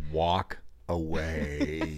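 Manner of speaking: slow, low, dragged-out warning; urgent hungry-hiker voice. Retold or imitated. Wife warning her husband off, low and slow. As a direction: drag it out low like a final warning. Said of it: "the same voice she uses when".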